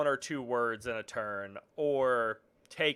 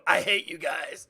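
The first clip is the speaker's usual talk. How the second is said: louder, slow dramatic last words; dying last words; 5 semitones higher, 6 dB louder than usual. Retold or imitated. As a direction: spit it out with your final breath. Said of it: "look at the rest of the party members and go".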